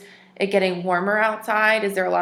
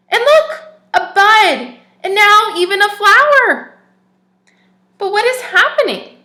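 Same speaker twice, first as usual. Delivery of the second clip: much higher, louder excited reading; storybook wonder; 12 semitones higher, 10 dB louder than usual. Acted. Teacher shows the children the new flower, excited and much higher. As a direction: gasp it out with wide-eyed wonder.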